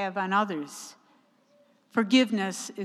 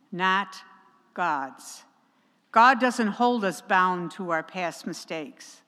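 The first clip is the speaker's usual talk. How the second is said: usual speaking voice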